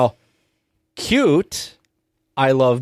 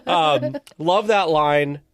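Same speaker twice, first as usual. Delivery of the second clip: normal talking voice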